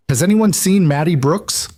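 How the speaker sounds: high, urgent plea to the crowd; panicked call. A narrator's reading. Berry vendor begs the market crowd for help, panicked. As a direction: ask everyone, frantic and high. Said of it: "a woman's voice shrill with panic".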